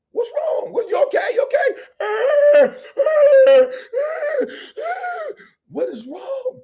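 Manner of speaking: gently imitating a concerned dad; worried parent.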